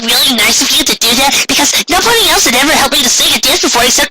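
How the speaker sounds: put-on high, quick, tumbling young pony chatter; bubbly filly rushing her words; excited Pinkie voice. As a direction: gush your thanks in one breath. Pinkie's long excited thank-you.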